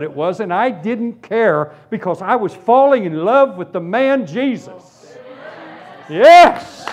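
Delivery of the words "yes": high, loud shout of victory; triumphant shout. Real, not acted. Preacher whipping up the congregation, joyful shout.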